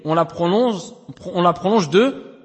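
normal talking voice

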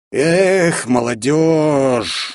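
slow, slurred tipsy drawl; slurred drunk voice. A narrator's reading. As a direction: drag the words out, drunk. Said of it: "заплетающимся языком произнёс".

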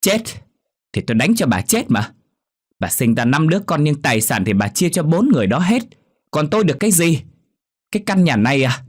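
high, loud bitter tirade; yelling loudly. Acted. Daughter yelling about the inheritance at her mother, high and loud. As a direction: shout the grievance at her face. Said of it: "không ngừng quát lớn".

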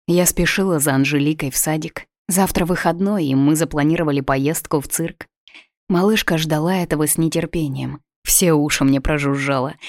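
usual speaking voice

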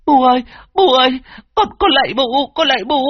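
high, loud begging cries; child screaming in pain. A narrator's reading. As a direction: beg for mercy under the whip. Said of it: "gào thét đau đớn cầu xin". (đứa trẻ gào khóc van xin)

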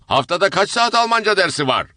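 father bragging about Germany, shouting. Acted. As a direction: jump up and shout over them. German-praising father asks.

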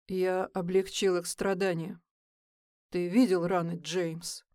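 calm. Acted. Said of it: "Спокойно отзывается Ник".

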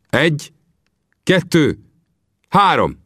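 Vinetú counting out the duel, high and measured; solemn count. Acted. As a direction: count slowly, each number its own beat.

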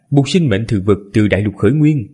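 plain reading voice

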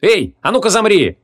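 high, forceful shout to halt; growled command. A narrator's reading. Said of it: "прорычала". (резкий рычащий приказ)

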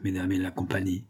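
salut moqueur, plus bas et plus doux; adieu ironique. A narrator's reading. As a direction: prendre congé en se moquant. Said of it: "dit ironiquement Fille-Noël". (congé ironique du vieux aux demoiselles)

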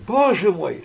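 high, loud dismayed cry; shocked cry. A narrator's reading. Lariosik cries out in dismay, high and loud. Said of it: "вскричал багровый Лариосик".

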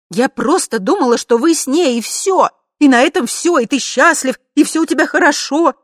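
high, loud, anxious babbling; high, rattled self-justification; agitated babble. A narrator's reading. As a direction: babble it out in distress. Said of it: "бормотала жена".

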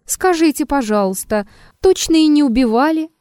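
high, taunting mock-polite question; needling, sing-song mockery. A narrator's reading. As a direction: ask with exaggerated curiosity, pitch leaping up. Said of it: "сказал издевающимся тоном".

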